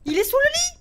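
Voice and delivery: very high, loud, rising cry; panicked squeal. Real, not acted. Repeats it in panic.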